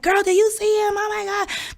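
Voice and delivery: high-pitched squeal without full volume; high, excited retold shriek; mock scream. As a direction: imitate girls screaming over the music. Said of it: "we're screaming like".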